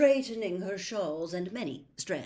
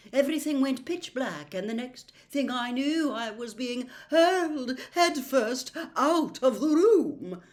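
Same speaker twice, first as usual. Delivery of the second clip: dramatic seer; 7 semitones higher, 8 dB louder than usual. A narrator's reading. Trelawney dramatising being thrown out, building.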